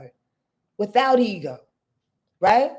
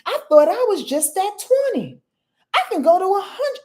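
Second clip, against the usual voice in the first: high excited realization; 10 semitones higher than usual. Pretend. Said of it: "the human says".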